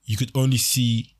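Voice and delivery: ordinary talk, nothing marked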